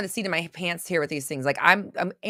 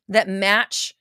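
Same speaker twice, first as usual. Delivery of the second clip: normal talking voice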